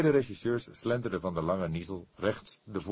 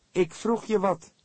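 much higher, louder, annoyed call; irritated call; 7 dB louder than usual. A narrator's reading. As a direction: snap it at him, fed up. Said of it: "riep hij geprikkeld".

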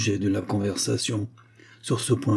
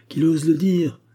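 louder, higher, fiery challenge; outraged young woman's exclamation; indignant cry; 7 semitones higher, 5 dB louder than usual. A narrator's reading. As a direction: cry it out as a defiant dare. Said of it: "s'écria la jeune fille".

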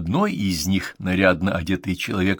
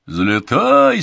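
higher, louder rowdy yell; heckler shout; 11 semitones higher, 7 dB louder than usual. Acted. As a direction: bellow it from the gallery. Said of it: "снова крикнули из зала".

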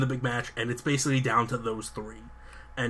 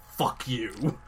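ordinary talk

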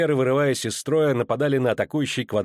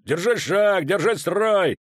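high, loud shouted orders; dwarf roars orders to the ranks; growled commands; 7 semitones higher, 3 dB louder, slower than usual. A narrator's reading. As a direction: drive the ranks forward. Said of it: "рычал Барклай Эльс".

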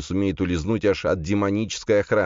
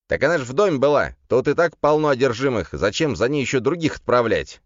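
raised, louder demon voice, bewildered; Легион; 8 semitones higher, 4 dB louder than usual. A narrator's reading. Demon defending himself to his master, baffled higher voice. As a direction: protest in bewilderment, pitched up and quick at first. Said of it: "недоумевал Легион".

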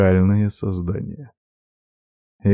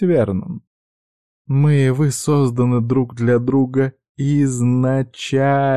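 [name]'s voice; 5 semitones higher than usual. A narrator's reading.

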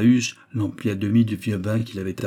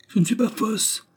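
much higher, wounded protest; indignant cry; 13 semitones higher than usual. A narrator's reading. Raymonde denying she is false, indignant cry. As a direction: cry out against the accusation. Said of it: "s'écria Raymonde".

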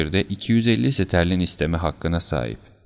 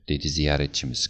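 ordinary delivery

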